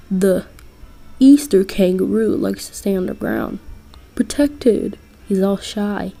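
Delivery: ordinary delivery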